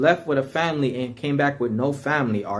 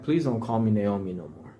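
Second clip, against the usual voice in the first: softer, lower, humbly pleading; calm and humble; 6 semitones lower, 5 dB quieter than usual. Retold or imitated. Naomi asks the townsfolk quietly, calm and humble. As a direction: ask gently, with no fight left. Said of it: "humble way".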